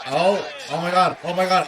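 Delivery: usual speaking voice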